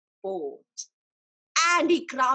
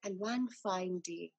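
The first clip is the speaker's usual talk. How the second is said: hushed storytelling; 6 semitones lower, 10 dB quieter than usual. A narrator's reading. Sad waiting in story.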